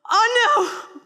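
high, loud dawning dread; oh-no panic. Retold or imitated. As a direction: groan it as the realisation lands. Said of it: "I'm going".

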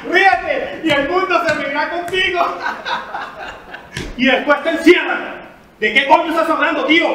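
high and loud, wild; unhinged outburst. Acted. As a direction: rant like a madman.

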